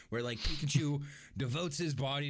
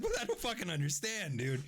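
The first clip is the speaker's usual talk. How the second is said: high-pitched tearful complaint; hammed-up weepy bafflement; mock bawling; 6 semitones higher than usual. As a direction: whine it as if weeping. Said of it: "I was bawling".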